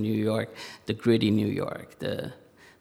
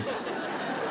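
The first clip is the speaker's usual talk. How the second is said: short quiet laugh at own joke; a little chuckle to himself; soft amused laugh; 7 dB quieter than usual. Real, not acted.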